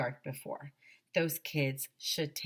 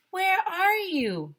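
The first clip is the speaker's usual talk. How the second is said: loud, high, drawn-out call; high searching shout; 9 dB louder, slower than usual. A narrator's reading. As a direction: holler slowly for someone lost. Teachers calling for Ella.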